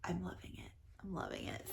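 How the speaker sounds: a private whispered rave; whispered delight. Real, not acted. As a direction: hush it to yourself, savoring. Gushing, repeated to herself.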